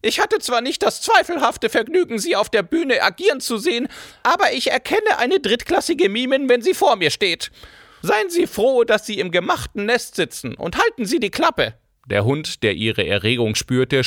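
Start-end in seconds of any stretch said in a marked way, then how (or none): 0.0-11.7 crush the actress with contempt; venomous old baroness. A narrator's reading. Baroness berates Cora. (giftige alte Baronin)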